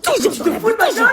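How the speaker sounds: loud, angry shouting; heated, high-volume outburst; furious quarrel. Acted. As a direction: shout it at your spouse mid-row. Parents shouting at each other.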